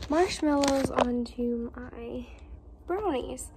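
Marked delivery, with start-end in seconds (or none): none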